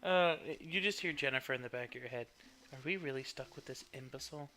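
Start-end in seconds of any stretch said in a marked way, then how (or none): 2.7-4.5 soft, scornful inner voice; quiet disdain. Acted. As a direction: murmur it like a sneering voice in his head. GM voices Jennifer in head.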